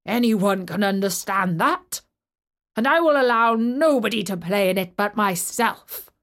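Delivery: loud harsh giant voice; stern giant refusing everyone; low harsh voice. Acted. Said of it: "said the giant".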